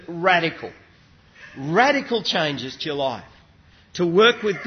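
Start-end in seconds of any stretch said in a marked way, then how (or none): 0.0-2.8 radical set apart and repeated with weight; stress on radical. Real, not acted. Preacher stressing that word to congregation, weight on radical. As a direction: give radical its own deliberate beat. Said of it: "I stress that word".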